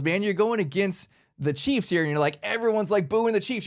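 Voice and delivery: normal talking voice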